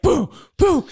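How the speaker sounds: loud, high boom sounds; imitated shotgun blasts; loud mock gunshots. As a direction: shout the booms like shotgun blasts. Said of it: "And I was like".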